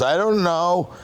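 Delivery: voce spaesata e acuta della moglie ferita; high, lost, a hint of panic. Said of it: "She goes".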